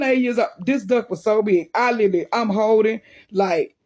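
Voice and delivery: plain reading voice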